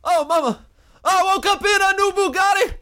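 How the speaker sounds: a dying soldier sobbing out absurd news; Southerner dying at war. Acted. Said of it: "a Southerner dying at war".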